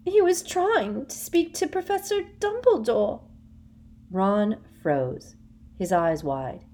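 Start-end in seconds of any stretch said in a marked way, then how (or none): 0.0-3.2 high, gulping fake confession; feigned tearful. A narrator's reading. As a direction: pretend to crack. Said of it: "gulped Hermione".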